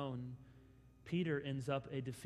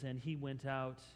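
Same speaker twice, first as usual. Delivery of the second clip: sorrowful reading; 6 semitones lower, 8 dB quieter than usual. A narrator's reading. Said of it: "Luke's gospel says".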